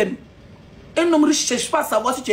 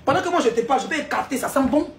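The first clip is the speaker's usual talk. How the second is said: normal talking voice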